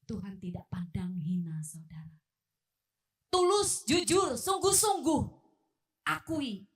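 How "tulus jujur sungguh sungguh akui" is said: loud, higher preaching punch; emphatic exhortation. Real, not acted. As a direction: drive each word home forcefully to the congregation. Preacher urging sincere confession. (berseru tegas dan lantang)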